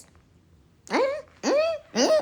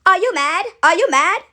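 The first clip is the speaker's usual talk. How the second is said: loud, raised goading questions; mock taunt; 4 semitones higher, 7 dB louder than usual. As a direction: jab the question at them twice. Taunting critics.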